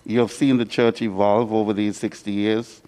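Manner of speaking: ordinary talk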